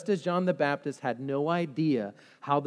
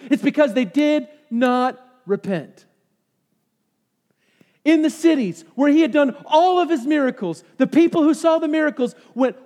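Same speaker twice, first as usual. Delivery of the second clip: loud, higher, driving sermon voice; fiery preaching; 6 semitones higher, 6 dB louder than usual. Acted. Pastor urging the church on repentance, full-force preaching.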